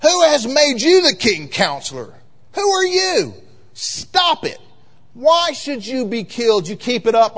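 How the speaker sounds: high, loud royal threat; puffed-up, preachy outburst as an angry king; indignant king. Acted. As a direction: cut the prophet off with outraged authority. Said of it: "the king said to him".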